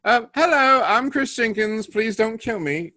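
put-on high-pitched little boy with a British accent; timid British kid. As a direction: plead politely as a scared British child. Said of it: "So I'm like".

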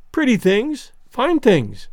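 put-on high, eager little girl showing her treasures; a child delighted by shiny stones; enthusiastic child. A narrator's reading. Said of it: "cried the little girl enthusiastically".

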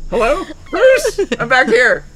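high loud call. Pretend. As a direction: call out to someone far away. Calling to Bruce behind paper.

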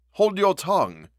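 high, sharp order to be silent; fierce rebuke from a rattled butler; ferocious. A narrator's reading. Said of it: "with a ferocity of accent".

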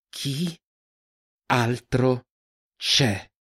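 one word at a time, long pauses; menacing interrogation. A narrator's reading. Huntress questions the man while twisting the knife, deliberate menace. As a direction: break the question into separate, deliberate words.

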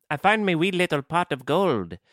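high Scottish-tinged mock Irish; botched Irish accent, leaning Scottish. Podcaster hunting for an Irish accent, sounding Scottish. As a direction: attempt Irish, slide into Scottish. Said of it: "everything is leaning Scottish at this point".

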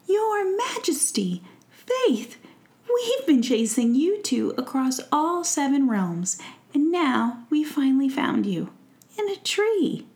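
high, loud eager call; excited fairies. Acted. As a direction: burst in with relief. Fairies call out finding prince.

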